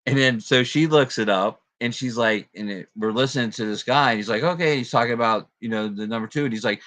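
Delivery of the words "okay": "Okay" in a much higher mock French voice; French guy imitation. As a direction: imitate the French teacher, lightly hammed. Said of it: "he's a French guy".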